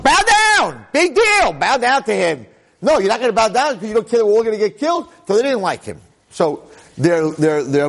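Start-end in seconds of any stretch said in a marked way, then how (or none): 0.0-2.5 scornful mock outcry. Mocking the people's complaint. 2.8-5.1 mock accusation.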